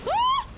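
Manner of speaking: high, loud yelp; sudden high-pitched shriek; startled scream. Real, not acted. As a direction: scream as if jumped. Startled by attack in game.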